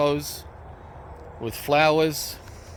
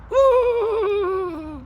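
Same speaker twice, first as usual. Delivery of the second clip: high excited woo; excited whoop. Real, not acted. Cheers about the cold.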